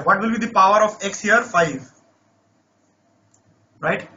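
ordinary talk

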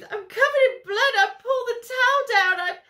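high, loud hysterical shriek; panicked scream. Acted. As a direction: scream it out in horror. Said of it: "Gret screams".

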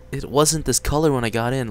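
ordinary talk, nothing marked

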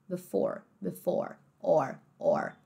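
low, soft "before" with a sounded R; American R. Shown as a demonstration. Teacher drilling the ending, R sounded in "before". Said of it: "som de O e R".

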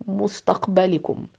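breathy whisper; whispering to his stunned friends. Acted. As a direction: breathe the word out.

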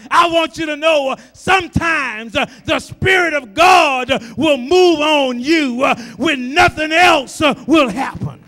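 loud, rising preacher's cry; full-voiced gospel preaching; preaching at full force. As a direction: preach it out to the congregation. Shouted preaching climax.

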